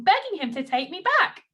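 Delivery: usual speaking voice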